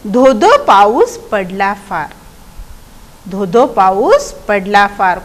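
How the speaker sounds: slow, drawn-out verse rhythm; poem recitation. Shown as a demonstration. Teacher reciting the next stanza about rain, slow recitation. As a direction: stretch each word of the verse. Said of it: "पुढच्या कडव्यामध्ये म्हटलेलं आहे".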